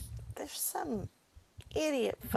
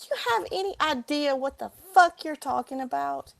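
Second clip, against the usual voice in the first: louder, heated challenge; angry confrontation; 5 semitones higher, 6 dB louder than usual. Retold or imitated. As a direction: tell off the stranger behind you. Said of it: "I said".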